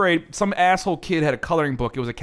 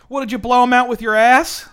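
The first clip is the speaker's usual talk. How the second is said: much higher, louder teasing mom voice; mock mom; 11 semitones higher, 10 dB louder than usual. [name] voicing his mom mocking his ripped pants, high and loud. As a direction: ham up the mom's crude joke.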